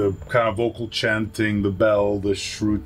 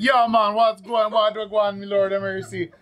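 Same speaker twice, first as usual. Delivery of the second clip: mock Jamaican accent; 9 semitones higher than usual. Greeting a Jamaican person in a hammed-up Jamaican accent. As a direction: cheerfully overdo a Jamaican accent. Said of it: "doing the Jamaican accent".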